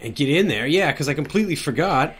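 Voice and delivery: ordinary delivery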